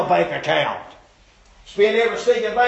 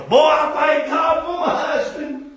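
higher, proud testimony shout; bragging wife; 7 semitones higher than usual. Retold or imitated. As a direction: boast about him to the whole church. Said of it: "start bragging".

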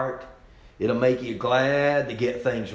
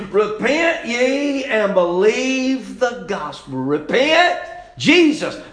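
much higher, loud, slow proclamation; full-force preaching; 9 semitones higher, 7 dB louder, slower than usual. Real, not acted. Preacher proclaiming repentance from Mark, full-force shout. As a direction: belt out the scripture to the congregation.

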